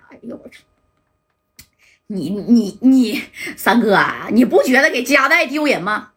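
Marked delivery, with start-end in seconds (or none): none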